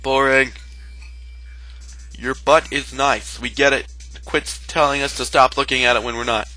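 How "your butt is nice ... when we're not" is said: usual speaking voice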